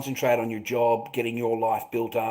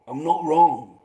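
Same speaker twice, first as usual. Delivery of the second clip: punchier, louder repeat; louder insistence; slower than usual. Acted. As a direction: insist it out loud.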